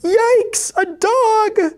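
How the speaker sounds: very high, alarmed cat voice; shrieky cry for help; panicked squeal. A narrator's reading. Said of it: "the cats squealed in panic".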